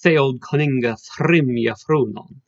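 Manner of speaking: put-on "Theod coninga thrim yafrunon" slowly in Old English pronunciation; Old English recitation. Shown as a demonstration. Showing students the next line's alliteration, slow Old English recitation. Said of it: "and the next line".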